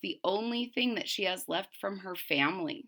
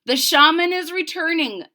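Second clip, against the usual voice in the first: higher, louder announcing call; runner calling out; 9 semitones higher, 11 dB louder than usual. Acted. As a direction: call it across the camp. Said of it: "the runner called".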